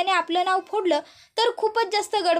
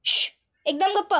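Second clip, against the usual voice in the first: a slightly raised, higher mock shout to silence; mild retold shout of gappa basa; narrated shout; 4 semitones higher than usual. A narrator's reading. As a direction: hush them with a light pretend yell, ending on a sharp shh. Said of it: "काव्य लगेच ओरडते".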